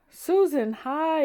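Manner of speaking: high, loud happy yell; yelling greeting. A narrator's reading. Boy greeting his cousin at the car, happy yell. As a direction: shout hello across the yard. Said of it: "yelled Timmy".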